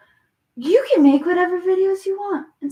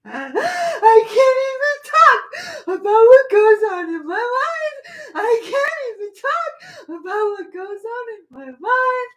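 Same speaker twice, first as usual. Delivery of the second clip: mock sobbing; 5 semitones higher, 5 dB louder than usual. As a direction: ham up a crybaby wail. Mocking someone's words, fake crying.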